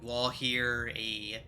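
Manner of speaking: slow dramatic narration. Acted. GM builds suspense before the shriek.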